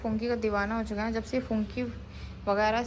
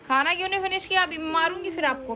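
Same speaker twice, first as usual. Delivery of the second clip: higher, louder motherly telling-off; mild scolding; 6 semitones higher, 3 dB louder than usual. Real, not acted. Mother scolding child over unfinished food. (हल्की डांट लगाते हुए)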